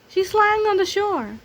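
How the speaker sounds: high, loud chick call. Acted. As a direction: call out in a little voice. Toto continues.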